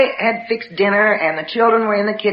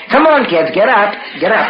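put-on rousing the kids. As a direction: rouse sleeping children briskly. Rousing sleeping children after low narration.

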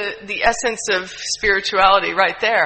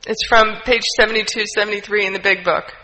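ordinary talk

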